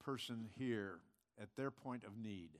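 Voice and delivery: low, soft, measured praying voice; pastoral prayer. Real, not acted. Pastor praying for the country before the congregation, hushed prayer.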